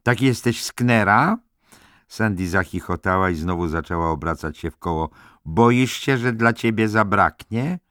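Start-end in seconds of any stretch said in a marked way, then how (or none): 0.0-1.4 wysoki, głośny, przeciągany głos; zaczepka dziewczyny. Acted. Tipsy Sandy teases. 5.5-7.8 wysoki, głośny, zaczepny głos; zaczepka dziewczyny. Acted. Tipsy Sandy teases.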